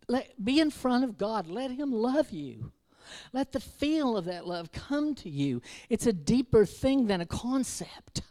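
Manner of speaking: loud, high fervent preacher; passionate preaching. Acted. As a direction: urge them to feel God's love. Preaching at full force.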